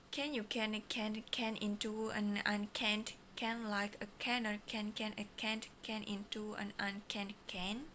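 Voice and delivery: quick rattled-off twister; fast mode. Shown as a demonstration. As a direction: rush through it. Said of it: "The first mode".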